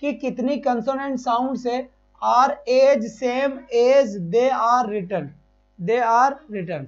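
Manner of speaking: teacher slowly unpacking a question; slow reading. Shown as a demonstration. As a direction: question read very slowly, word by word. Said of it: "ये question क्या कह रहा है".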